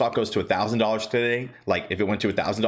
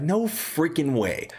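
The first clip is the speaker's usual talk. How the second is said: slow, higher "no freaking way"; amazed disbelief; 6 semitones higher, slower than usual. Retold or imitated. To fiance after hearing the price, slow amazed disbelief. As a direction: let the good news sink in, astonished. Hearing stock hit fifty-eight.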